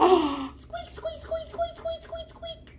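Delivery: tiny squeaky mouse voice; mouse squeaks. Acted. Puppet mouse noises.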